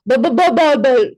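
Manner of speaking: "ball" after repeated stuck b sounds; difficulty initiating. Shown as a demonstration. Showing a child's trouble starting a word, struggling stammer. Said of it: "you could have".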